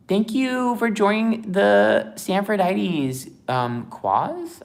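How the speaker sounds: very high, loud, drawn-out shout-out; announcing thanks. Real, not acted. Streamer thanks a new member, high drawn-out announcing.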